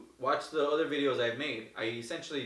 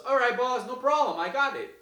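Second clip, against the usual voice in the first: high, louder eager agreement; excited yes; 10 semitones higher, 5 dB louder than usual. Retold or imitated. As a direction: jump at the offer, thrilled. Joe accepting partnership.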